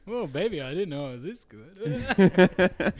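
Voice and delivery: high, loud, slow hammed-up lover; laughing mock come-on to a lover; mock seductive. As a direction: ham it up like a cheesy seducer.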